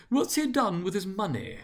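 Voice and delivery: a high, foreign-sounding gent; red-faced gentleman, high. A narrator's reading. Red-faced gentleman asking the group, high voice. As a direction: pitch it up, nosy and curious. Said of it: "asked a red-faced gentleman".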